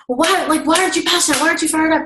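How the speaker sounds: high, pumped-up demanding voice; mock hyped-up pushback. Shown as a demonstration. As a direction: overdo the fired-up energy. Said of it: "I'm not gonna respond with".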